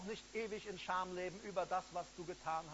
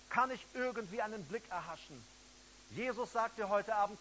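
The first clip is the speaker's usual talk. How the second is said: ordinary delivery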